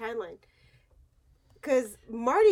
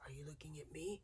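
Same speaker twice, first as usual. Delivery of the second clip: low, soft tough-guy impression; Clint Eastwood; 5 semitones lower, 6 dB quieter than usual. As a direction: go low and near-whispered, slow at the end. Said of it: "Clint Eastwood would say it".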